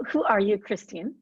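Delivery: ordinary delivery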